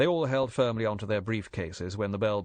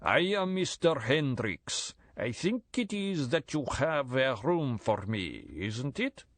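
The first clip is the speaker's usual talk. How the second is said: put-on slow, higher Central European accent with stiff foreign phrasing; thick Central European accent; 4 semitones higher, slower than usual. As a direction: Central European accent, each word placed with care. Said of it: "said in a thick Central European accent".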